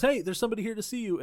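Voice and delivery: high, quick call across the gym. Retold or imitated. Trainer telling a sparring fighter of a visitor, calling out. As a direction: imitate someone hollering a quick heads-up. Retold man calling out to Lloyd.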